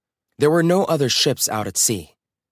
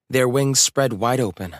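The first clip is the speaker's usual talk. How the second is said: plain reading voice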